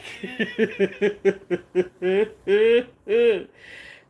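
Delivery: long loud laughing fit; loud laughter. Real, not acted. Laughing at her own story, loud laughter. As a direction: break up laughing.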